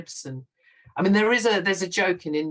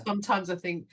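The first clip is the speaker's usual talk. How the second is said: usual speaking voice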